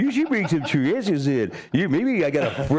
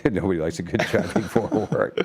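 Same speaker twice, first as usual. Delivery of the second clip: real laughter through the words, low; laughing; 8 semitones lower than usual. Real, not acted. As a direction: say it while chuckling. Host laughs at guest's remark.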